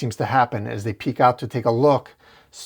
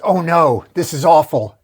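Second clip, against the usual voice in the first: alarmed cry; 5 semitones higher, 7 dB louder than usual. A narrator's reading. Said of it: "Spider-Man screams".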